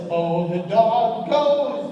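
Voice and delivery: drawn-out, very slow call; slow sing-song prompt. Shown as a demonstration. Comedian prompting audience animal sounds, slow drawn-out call. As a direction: stretch it out for the crowd to answer. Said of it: "It goes like this".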